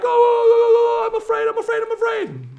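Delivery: quake in exaggerated, squealing fear; hammed-up cowardly panic; mock terrified church. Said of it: "The church is like".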